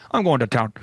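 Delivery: low, easy-going Minnesotan line; lightly voiced Minnesotan local; Minnesotan accent.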